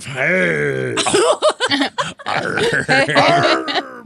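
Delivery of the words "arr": pirate arr. Acted. Pirate cry in costume.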